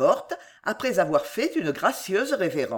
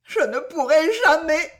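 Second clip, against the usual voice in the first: high, louder sobbed cry; sobbing; 9 semitones higher, 4 dB louder than usual. A narrator's reading. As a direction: cry it out despairing. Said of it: "retomba en sanglotant".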